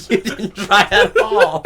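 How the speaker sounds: high, loud laughing tease. Real, not acted. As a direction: tease slowly while laughing. Teasing friend, laughing.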